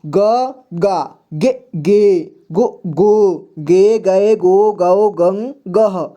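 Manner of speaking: "ग, गा" onward in separate, slowed syllables; clear syllable recitation. Shown as a demonstration. Teacher models reading every row, "ग" to "गह" syllable by syllable. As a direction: recite from "ग" to "गह", short vowel then long. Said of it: "इस तरह से सभी को पढ़ना है".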